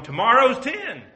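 high, loud mock eagerness; boastful eager quote. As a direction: brag about tomorrow's ten converts. Boasting about converts tomorrow.

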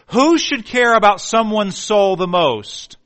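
slow, loud sermon question; emphatic preaching. Real, not acted. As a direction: hammer the question home.

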